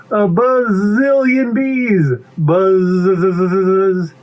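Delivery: high, slow, stretched z sounds; hammed-up buzzing joke. Bee repeats the pun to pea, hamming it up. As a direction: drag out "bazillion" into a long buzz.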